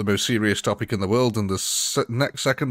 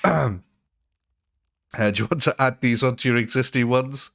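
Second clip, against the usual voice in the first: ordinary talk, nothing marked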